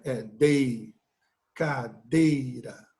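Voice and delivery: low, drawn-out "cadeira" resting on the syllable "dei"; stress on DEI. Shown as a demonstration. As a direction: isolate "dei", then stretch it inside "cadeira". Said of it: "qual é a sílaba tônica".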